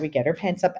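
usual speaking voice

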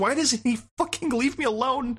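high-pitched, whining frustration; exasperated gamer rant. Acted. Player complains to Hamish about the pole guy, exasperated. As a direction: rant at an unfair enemy, pitch jumping up. Acting out rage at game enemy.

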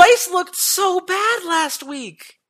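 high, slow, drawn-out disgust; exasperated. Real, not acted. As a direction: stretch out how bad they were. Exasperated rant about team.

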